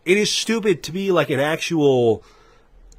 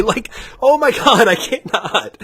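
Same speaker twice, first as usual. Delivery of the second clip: high, worked-up voice breaking into laughter; exasperated laughing; 6 semitones higher than usual. Real, not acted. Ranter overcome after screaming at brand loyalists, exasperated laughing. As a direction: laugh helplessly through the exasperation.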